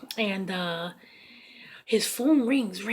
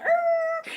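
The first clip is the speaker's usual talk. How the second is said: very high, held screeching noise; high-pitched mock tire screech; imitated car screech. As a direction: squeal like a car tearing off. Imitates car speeding away.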